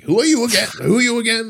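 high-pitched, badly faked actor impersonation; Al Pacino impression. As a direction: play the puzzled star in a high put-on voice. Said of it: "I can't do a good Al Pacino voice".

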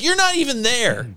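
high-pitched mock wife accusation; imitated accusing wife. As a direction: mimic the wife catching him out. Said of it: "She's like".

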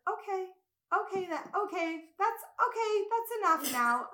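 small, high-pitched timid plea; quiet nice teacher. Retold or imitated. Storyteller imitating the gentle teacher interrupting two yelling students, meekly. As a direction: imitate a meek teacher feebly restoring order. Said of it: "the quietest, like, nicest woman".

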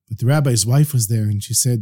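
ordinary delivery

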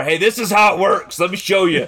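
high, loud mock explaining; acted-out husband showing how it's done; insistent demonstrating husband. Acts out husband instructing his wife.

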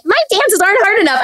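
very high mock whiny-kid voice; little girl complaining. Dancer mocking herself as a complaining contestant, high little-girl voice. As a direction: put on a small girl's high-pitched complaint. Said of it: "little chubby girl was like".

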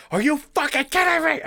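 high-pitched exasperated yell, pushed up; retold scream. Retelling yelling at girlfriend in car, high exasperated shriek. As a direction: shriek it like you've lost it over the wipers. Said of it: "I'm just, like, screaming while we're driving".

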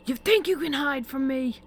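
high, loud enraged yell; spitting fury. A narrator's reading. Father cornering his daughter with a belt, spitting fury. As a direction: spew each word in a threatening rage. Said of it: "spittle spewing from his lips with each word uttered".

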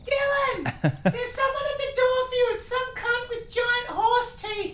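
very high mum calling upstairs. Pretend. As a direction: call the kid down.

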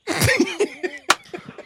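a high burst of laughter at the end; amused laugh after teasing his colleague. Real, not acted. Laughing at colleague's dessert binge.

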